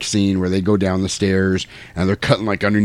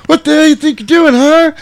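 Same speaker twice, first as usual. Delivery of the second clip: badly overacted high, loud yell; Michael; 7 dB louder than usual. Michael confronts the biker who nearly killed him, hammed-up yell. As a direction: imitate a terrible actor's furious outburst. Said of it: "this is Michael's worst delivered line".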